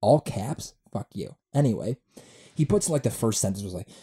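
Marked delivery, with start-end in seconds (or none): none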